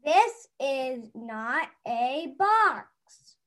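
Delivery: each word slowly and firmly set down; a child spelling it out, slowly; slow insistent kid. Acted. Child reading the kid part, answering the grown-up slowly and firmly. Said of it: "[name]'s gonna read the kid voice".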